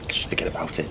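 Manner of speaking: hushed, brushing it off; whispered. Acted. Doctor hiding his worry from Jenny, whispering. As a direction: whisper it away like nothing.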